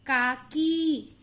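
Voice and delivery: "काकी" slowly, with a held final ee; long vowel. Shown as a demonstration. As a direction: stretch the end of "काकी". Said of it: "ई देर तक हो रहा है".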